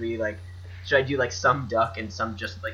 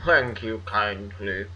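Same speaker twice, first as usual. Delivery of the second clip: rich guy voice; 2 semitones lower, slower than usual. Acted. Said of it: "It's my rich guy voice".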